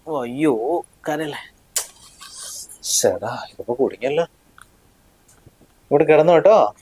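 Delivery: ordinary delivery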